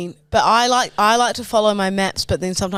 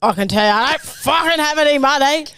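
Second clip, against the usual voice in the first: hammed-up, higher gangster with a touch of Michael Caine; garbled, swooping threat with rising ends; Alfie; 4 semitones higher than usual. Copying the gangster's ransom refusal, hammed-up and high. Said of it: "That's a bit of Michael Caine in that".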